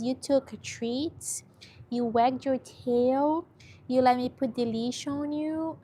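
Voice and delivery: high, slow singsong pet praise; cooing praise to a dog. Real, not acted. Telling a shelter dog he was so brave, cooing. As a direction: gush slowly with big pitch swoops.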